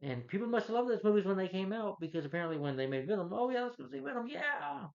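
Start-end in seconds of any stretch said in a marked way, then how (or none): 3.3-4.9 high, gushing fake enthusiasm; mock excited fan. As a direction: parody an eager fan. Mocking eager moviegoers.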